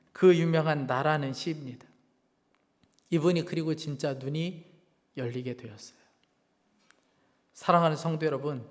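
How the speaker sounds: normal talking voice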